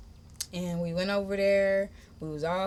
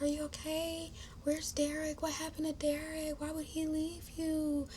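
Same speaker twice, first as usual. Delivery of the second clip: high, hushed, worried questions; small high voice, checking gently; gentle concern; 9 semitones higher, 9 dB quieter than usual. Retold or imitated. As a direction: voice a softly concerned woman. Voices sister's wife checking on her.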